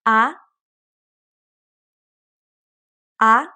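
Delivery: A sliding upward like a question; second tone. Shown as a demonstration. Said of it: "Let's try again".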